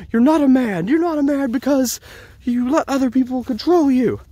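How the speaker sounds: imitating a girlfriend's hysterical outburst; retold frantic accusation. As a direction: climb into shrill panic as you accuse.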